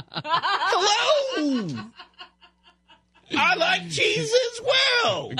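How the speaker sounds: very high, slow royal falsetto, laughing; hammed-up posh queen, drawn out. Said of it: "You wanna hear me do the Queen?".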